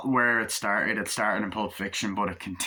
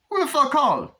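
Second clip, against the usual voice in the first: much higher, loud hammed-up tough guy; mock gangster yell; 6 dB louder than usual. Acted gangster dialogue from movie.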